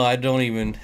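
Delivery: ordinary talk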